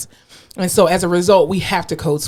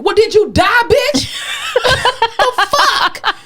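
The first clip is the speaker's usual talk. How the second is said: mock indignant outburst; 14 semitones higher, slower than usual. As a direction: fire back in hammed-up outrage. Mock outburst at an offended coworker.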